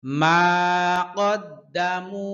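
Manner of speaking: slow, measured tajweed recitation; Quran recitation. Shown as a demonstration. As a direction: chant each word slowly. (ধীরে তাজবিদসহ কুরআন তিলাওয়াত)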